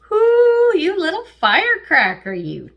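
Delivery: high, loud delighted whoop; excited whoop. Real, not acted. Tarot reader thrilled by the Libra reading, whooping. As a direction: cheer the card on.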